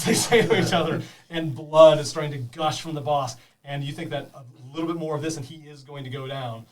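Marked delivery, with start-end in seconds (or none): none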